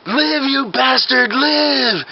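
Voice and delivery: high, drawn-out desperate plea; melodramatic yelling. Acted. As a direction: overact a movie doctor reviving a patient. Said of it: "I'll start yelling at him".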